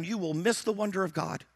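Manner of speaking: ordinary talk, nothing marked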